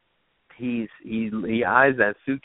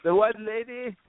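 put-on higher Jamaican accent, sly question; 12 semitones higher than usual. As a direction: Jamaican guy at the bar asks, Jamaican accent. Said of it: "there's this Jamaican guy there".

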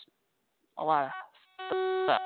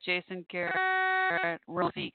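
drawn-out name; 12 semitones higher, much slower than usual. Real, not acted. Reading the trending names.

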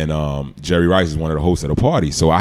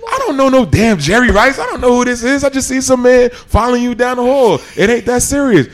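a Brooklyn mom telling off a stranger, pitched way up; scolding overprotective mom. Retold or imitated. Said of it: "So she's like".

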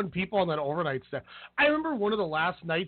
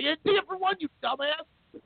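exasperated scolding; 10 semitones higher than usual. Retold or imitated. Narrator scolds coworker Cassidy over the laptop sales, exasperated mock scolding. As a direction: scold him like an exasperated boss. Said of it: "I'm like".

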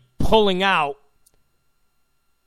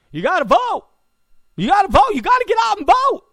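high, loud shouted plea to vote; mock Obama yelling; 13 semitones higher, 5 dB louder, faster than usual. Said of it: "Obama doing his".

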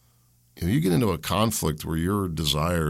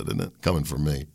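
ordinary talk, nothing marked

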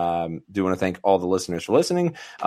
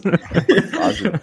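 mock Fozzie voice; 14 semitones higher than usual. Mocking the Fozzie voice.

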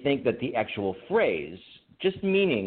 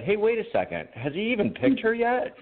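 higher-pitched imitation of a puzzled friend; retold co-host's question; 7 semitones higher than usual. Co-host asking five days ago, imitated in higher puzzled voice. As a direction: mimic a clueless question, pitched up. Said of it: "you said".

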